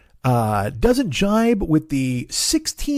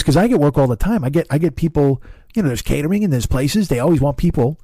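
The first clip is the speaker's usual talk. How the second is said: usual speaking voice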